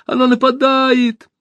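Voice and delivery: high, loud, slow warning cry; alarmed shout. A narrator's reading. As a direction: sound the alarm. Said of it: "крикнула Алиса".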